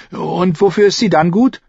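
put-on high-pitched girl's retort; challenging young girl. A narrator's reading. Girl questioning the coin's use, high and challenging.

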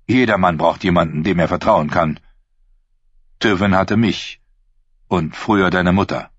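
usual speaking voice

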